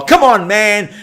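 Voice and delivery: high, loud mock Biden catchphrase; Biden impression. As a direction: pitch it up like doing Biden.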